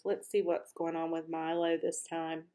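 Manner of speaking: plain reading voice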